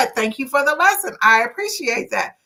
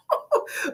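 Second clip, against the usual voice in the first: high laugh; amused high cackle; 6 dB quieter than usual. Real, not acted. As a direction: burst out in a high-pitched laugh. Amused by judge's retort.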